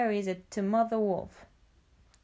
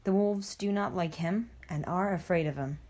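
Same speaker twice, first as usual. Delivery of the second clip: ordinary delivery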